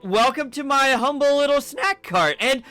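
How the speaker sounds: high jolly vendor welcoming a customer; cheery elf snack vendor. Acted. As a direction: greet the stranger like a jolly festive elf.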